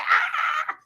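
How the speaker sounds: short high shriek of fright; scared scream. Retold or imitated. Crowd fleeing the loose snake, a short high scream. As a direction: yelp as if the snake lunges at you.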